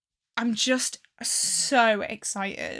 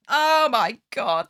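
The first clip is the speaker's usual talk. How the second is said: excited; 6 dB louder, slower than usual. Real, not acted. Gushing at the dream.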